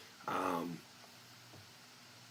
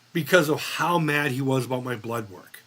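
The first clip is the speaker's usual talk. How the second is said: ordinary delivery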